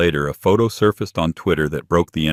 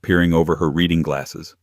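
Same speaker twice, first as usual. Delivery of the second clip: usual speaking voice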